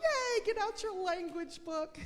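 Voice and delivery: high, beaming enthusiasm; cheerful yay. Shown as a demonstration. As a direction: gush like a kid thrilled for class. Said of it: "I'll be going".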